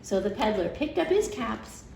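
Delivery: ordinary delivery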